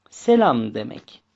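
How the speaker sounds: ordinary delivery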